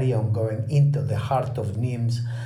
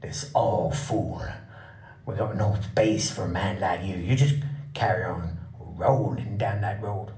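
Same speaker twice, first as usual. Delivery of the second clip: gruff campsite owner; 7 semitones lower, 4 dB quieter than usual. Retold or imitated. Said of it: "They say".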